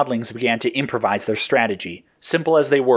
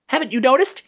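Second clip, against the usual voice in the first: high-pitched, agitated character voice; high, anxious sidekick; alarmed cry; faster than usual. A narrator's reading. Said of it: "Yellow cried".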